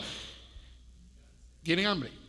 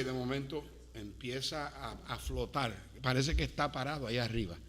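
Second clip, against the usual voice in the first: tender imagery; 10 semitones lower, 7 dB quieter than usual. Acted. Soft airplane imagery passage.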